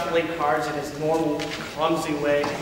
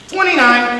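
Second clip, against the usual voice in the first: loud; 6 semitones higher, 9 dB louder than usual. Acted. Chris calls his score.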